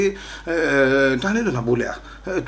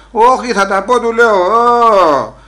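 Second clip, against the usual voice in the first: defiant shout; 6 semitones higher, 7 dB louder, much slower than usual. Retold or imitated. Defiant refusal to be silenced.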